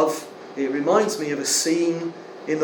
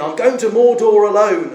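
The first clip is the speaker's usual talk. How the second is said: "Mordor" hit louder and higher; insistent call; 5 semitones higher, 8 dB louder than usual. Retold or imitated. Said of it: "Frodo says again".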